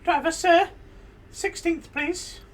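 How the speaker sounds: high, loud, put-on passenger voice; odd passenger. Strange passenger addressing the taxi driver, high put-on voice. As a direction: pitch it way up like a strange fare. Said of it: "Passenger enters the cab".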